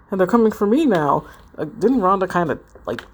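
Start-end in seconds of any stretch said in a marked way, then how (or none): none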